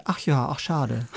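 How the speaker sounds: a slow, lightly voiced parent, sorry for you; mock-disappointed mother sighing over a son's TV series; pitying parent. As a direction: imitate mom's pity, drawn out. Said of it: "Immer lustig, wenn ich".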